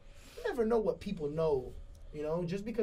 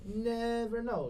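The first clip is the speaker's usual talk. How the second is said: slow echo; 7 semitones higher, much slower than usual. Acted. Slow echo of own phrase.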